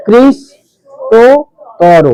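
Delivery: কৃষ্টতর as krish, to, toro with long gaps; split into syllables. Shown as a demonstration. Teacher separates the syllables for pupils, very slow and broken up. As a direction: pause after each part of কৃষ্টতর. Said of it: "বিচ্ছেদ তো হবে".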